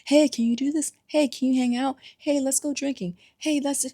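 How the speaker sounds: rapid-fire mock demands; imitating pushy friends. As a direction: pester like needy friends. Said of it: "everybody wants a piece of you".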